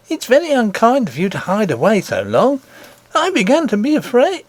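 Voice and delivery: high, bright-pitched worried nurse voice. Acted. Nurse scolding the princess for hiding, high voice.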